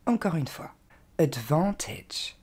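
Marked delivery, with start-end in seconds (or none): 1.2-2.4 ADVANTAGE slowly as "ud-VAN-tedge"; slow teaching model of ADVANTAGE; correct English pronunciation. Shown as a demonstration. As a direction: stretch VAN in ADVANTAGE, short "edge" ending. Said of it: "Il ne faudra pas dire".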